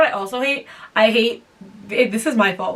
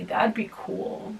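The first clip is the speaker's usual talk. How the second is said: slow, breathy half-whisper; slow whispered hint to viewers; whispered aside; 9 dB quieter, much slower than usual. Real, not acted. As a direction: drop to a sly whisper.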